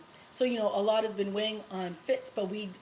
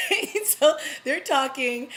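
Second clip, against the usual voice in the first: a much higher, louder laughing "Right?"; 8 semitones higher, 8 dB louder, slower than usual. Real, not acted. Laughing at her own recap joke to the viewers. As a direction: burst out laughing as you ask.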